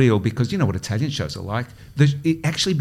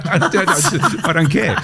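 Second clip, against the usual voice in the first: laughing; 4 semitones higher than usual. Real, not acted. Dismissing mum, laughing.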